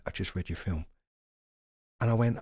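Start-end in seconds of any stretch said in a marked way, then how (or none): 0.0-0.9 a low, soft hint of Ray's voice; lightly voiced Ray, low and hushed; imitating Ray.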